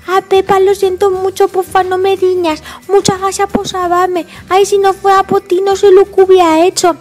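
put-on apologetic toddler in baby talk; little George. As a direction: say sorry like a small child.

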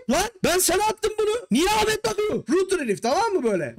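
high-pitched mock outrage; acted indignant complaint, pitched high; indignant protest. Acting sender indignant at being forwarded.

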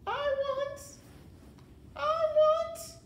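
repeated high, loud wails; sobbing cry. A narrator's reading. Mig sobbing to be heard, high wail. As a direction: wail each one at full pitch. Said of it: "sobbed Mig".

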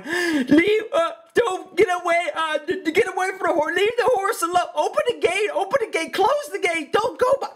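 high, flustered woman barking orders; mock a panicked announcer losing the plot; imitating a flustered lady on the PA, high and stammering; frantic loudspeaker lady. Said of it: "this lady on a loudspeaker going".

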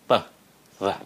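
"Tờ" said light and soft, barely touched. Shown as a demonstration. Teacher showing students a gentler "tờ" sound. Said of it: "nhẹ lại".

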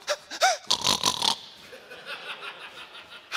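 high airy imitated giggle; high giggle snort. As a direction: laugh like the giggle-snort guy. Accountant laughs at his joke.

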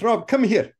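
high calling Italian director; Italian accent. Retold or imitated. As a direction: call him over, Italian style. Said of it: "heavy Italian accent".

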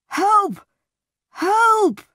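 high, loud desperate cries for help; shouting for help. Acted. Wounded man shouting for Rochester in the night, loud desperate cries. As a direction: scream for help down the corridor. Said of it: "I heard somebody shouting".

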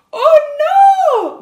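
very high, loud wail of dismay; squealed, drawn-out shout; piglet's shout. A narrator's reading. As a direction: cry out as a horrified piglet. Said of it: "gritó el cerdito".

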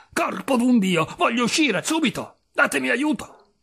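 high, urgent cries for help; high-pitched, exclaimed demands; furious yelling. A narrator's reading. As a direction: rage like a trapped wildcat.

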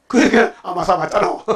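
amused, laughing at the end of the line; real chuckle trailing the words. Real, not acted. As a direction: make the point, then break into laughter. Preacher laughs at his own conclusion.

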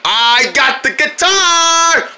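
slow, high crowing; play-acted triumph. Character who grabbed the guitar, slow high crowing. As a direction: stretch it out into a high sing-out.

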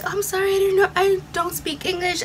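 high-pitched, apologetic seller voice; squeaky sorry, high and light. Retold or imitated. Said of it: "so she was like".

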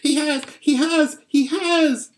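very high, loud little squeak; squeaking young squirrel. A narrator's reading. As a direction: squeal it frantically like a tiny squirrel. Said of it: "it squeaked".

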